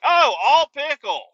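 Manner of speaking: high, slow, loud dismayed "oh"; disgusted surprise at a mouthful of pickle; mock dismay. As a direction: drag out the disappointment at the pickle. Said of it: "and I'm like".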